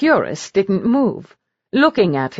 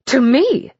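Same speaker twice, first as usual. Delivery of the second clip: a sudden, higher, louder jolt of surprise; startled; 7 semitones higher, 4 dB louder than usual. A narrator's reading. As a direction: caught off guard, voice jumping up.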